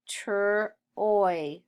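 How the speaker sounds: "tr" alone, then "oy"; sounded out. Shown as a demonstration. Teacher sounding out Troy for pupils, sounded out. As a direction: separate tr from the oy sound.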